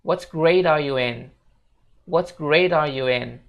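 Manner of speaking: "What grade are you in" slow, said as "grader you in"; slow linked sentence. Shown as a demonstration. Teacher models the full linked question slowly. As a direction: join all the words, slowly. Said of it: "full versionnya bakal kayak gini".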